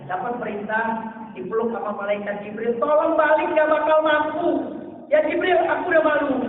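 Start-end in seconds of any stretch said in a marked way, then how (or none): 2.8-6.5 raised, urgent begging; loud plea. Acted. Jibril urging the Prophet to return, loud plea. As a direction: push hard, pleading to go back.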